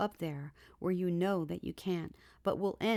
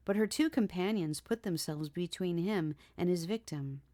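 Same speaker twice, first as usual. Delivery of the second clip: ordinary delivery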